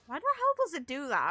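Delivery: normal talking voice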